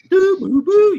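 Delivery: high, loud electronic ringtone mimicked by voice; high-pitched "doo boo boo" ringtone; Skype ringtone imitation. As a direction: sing out the Skype call jingle. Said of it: "I hear my, my Skype go off".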